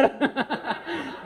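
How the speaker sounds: high-pitched burst of laughter; laughing. Real, not acted. Speaker laughing along with the wives, high laughter. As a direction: crack up helplessly. Cracking up at own joke.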